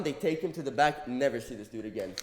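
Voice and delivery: normal talking voice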